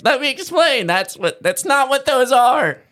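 high, frantic plea; cowering plea. Retold or imitated. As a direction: beg to explain. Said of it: "And he's like".

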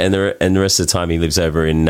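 ordinary talk, nothing marked

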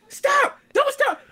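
high, shrill-free mock yell of a panicking mom; imitating mom shouting stop at the corner. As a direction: yell like a scared parent in the passenger seat. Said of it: "we turn in a corner".

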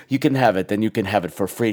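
low put-on man's voice; antique shopkeeper. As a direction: imitate a solemn movie shopkeeper. Mimicking the shopkeeper.

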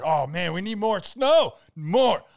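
high, loud greedy demand; mock eagerness. Mimics go-hard guy wanting snow.

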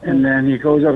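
usual speaking voice

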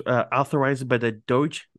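normal talking voice

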